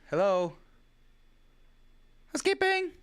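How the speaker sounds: high, sing-out call to whoever is there; playful calling out. Pretend. Host calling to an unseen visitor, mock housekeeping call.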